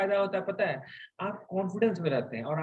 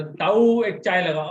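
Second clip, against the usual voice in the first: slow, louder mock Haryanvi shop call; 6 dB louder, slower than usual. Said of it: "Haryanvi tone है".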